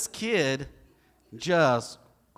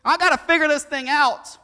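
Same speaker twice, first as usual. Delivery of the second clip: anxious protest; 10 semitones higher, 11 dB louder than usual. Pretend.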